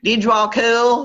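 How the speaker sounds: loud, high, drawn-out Southern accent. Real, not acted. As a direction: lay the Southern accent on thick and slow. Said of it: "that's my southern accent".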